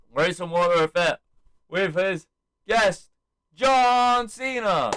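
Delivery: building to a high, drawn-out shout; announcer's stretched-out name call; imitated ring introduction. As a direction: introduce the star guest to an arena. Acts out Waller introducing his guest.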